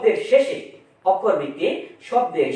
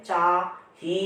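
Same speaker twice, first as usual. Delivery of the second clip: "চাহি" drawn long and slow; "চাহি" lengthened slowly; stretched out; much slower than usual. Shown as a demonstration. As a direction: hold the vowels of "চাহি" to show they can be stretched. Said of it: "টেনে টেনে পড়তে পারতেছি".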